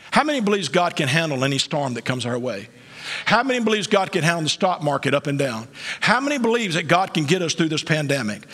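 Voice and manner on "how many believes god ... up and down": normal talking voice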